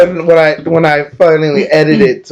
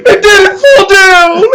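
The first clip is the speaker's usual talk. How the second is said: high, loud, laugh-cracked voice; 4 dB louder than usual. Real, not acted. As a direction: choke out words while laughing. Laughing retelling letter.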